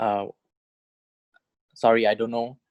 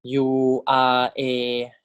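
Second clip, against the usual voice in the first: "U R A" drawn out very slowly; teacher spelling it out slowly; much slower than usual. Shown as a demonstration. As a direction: stretch each letter of "U R A". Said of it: "So this is".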